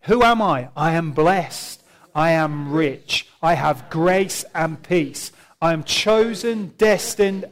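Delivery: loud, slow, emphatic preacher's recitation; leading group declaration. Real, not acted. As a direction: lead the congregation line by line. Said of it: "read these with me".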